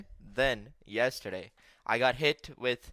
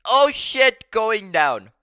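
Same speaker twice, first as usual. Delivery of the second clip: loud, high panicked exclamation; mock alarm; 9 semitones higher, 13 dB louder, much slower than usual. Lifter stuck halfway up a squat, acting out alarm. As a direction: replay the moment of sudden panic.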